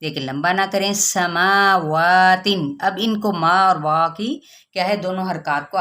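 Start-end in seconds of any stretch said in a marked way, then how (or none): 0.9-2.8 समावातिन very slowly, मा and वा stretched equally. Shown as a demonstration. Teacher shows equal madd on समावातिन, slow. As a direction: stretch both long vowels evenly. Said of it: "equally खींचना है".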